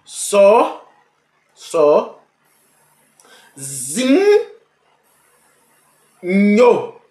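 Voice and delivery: "Só, só, zin, nho" spelled out in loud, separated beats; syllable by syllable. Shown as a demonstration. Teacher splitting a word into syllables, loud and slow. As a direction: clap out each syllable of "sozinho". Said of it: "Usar as duas mãos".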